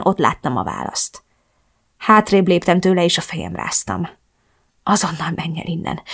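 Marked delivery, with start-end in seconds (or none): 4.8-6.0 whispered order. Acted. Ordering mother out.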